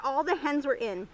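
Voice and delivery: ordinary delivery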